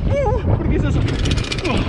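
squeaky startled yelp; jolted by a sudden slip. Real, not acted. Startled by a bike glitch.

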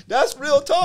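laughing. Real, not acted. Laughing at host's joke.

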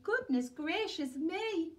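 high slow astonished exclaim. A narrator's reading. Mother seeing the water monster, high slow astonishment. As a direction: gasp it out slowly.

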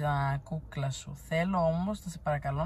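ordinary talk

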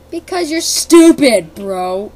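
loud slow insult; slow and loud playful jab; loud mock insult.

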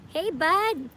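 ordinary talk

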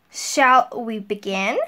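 ordinary delivery

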